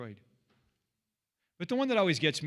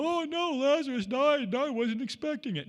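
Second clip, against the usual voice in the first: mock dismay; 11 semitones higher, 4 dB louder than usual. Mock surprised Jesus.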